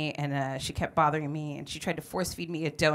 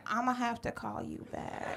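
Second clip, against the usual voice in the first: softer, sheepish mutter; embarrassed hangup; 4 semitones higher, 9 dB quieter than usual. Retold or imitated. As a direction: hang up meekly, caught out. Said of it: "she was like".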